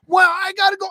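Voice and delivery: very high wailing child impression; crying daughter. Daughter refusing to leave the bathroom, high upset wail. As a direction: imitate a daughter's protesting whine. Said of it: "she, like, crying".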